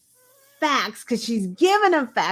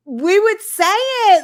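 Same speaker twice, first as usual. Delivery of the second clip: emphatic; 7 semitones higher, 9 dB louder than usual. Real, not acted. Emphatic point.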